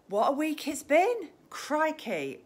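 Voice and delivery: high, loud exclamation of delight; bright exclaimed wonder; excited. Real, not acted. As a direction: gush about the busy week. Excited live stream opener.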